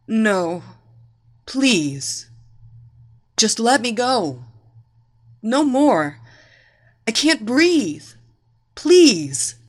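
begging in short, broken bursts; increasingly frantic plea; desperate pleading. A narrator's reading. Smothered woman begs for air.

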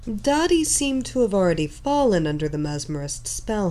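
ordinary delivery

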